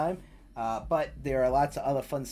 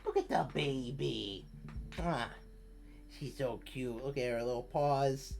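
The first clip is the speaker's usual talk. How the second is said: cooing to cat; 13 dB quieter than usual. Real, not acted. Streamer picking up her sleepy cat, soft cooing. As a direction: coo over a sleepy kitten.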